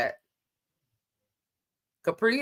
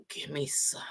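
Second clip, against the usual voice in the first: whisper; 2 semitones lower, 10 dB quieter than usual. Real, not acted. Jokingly begging for a drink.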